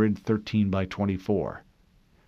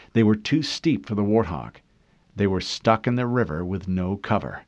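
plain reading voice

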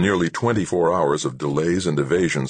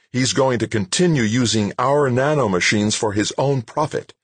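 higher, insistent, controlled anger; contained anger, pitched up throughout; temper held in; 6 semitones higher than usual. A narrator's reading. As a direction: threaten while holding back your temper. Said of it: "Holding on to her swooping temper".

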